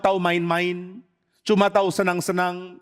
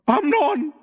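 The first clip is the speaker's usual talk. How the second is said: Tamar naming him; 8 semitones higher than usual. Acted. Tamar names the abuser.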